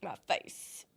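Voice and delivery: breathy whisper. Real, not acted. Whispered aside.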